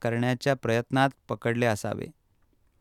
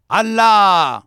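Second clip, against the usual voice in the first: high, very loud drawn-out yell; big raised cry with a falling end; commanding shout; 9 semitones higher, 13 dB louder, much slower than usual. A narrator's reading. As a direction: shout it out as a stern demand. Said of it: "पुन्हा बोट दाखवीत तो ओरडला".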